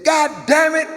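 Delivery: a father shouting at his son over the hammer; strained angry dad shout; furious dad. Retold or imitated. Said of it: "my daddy looked right in my face and said".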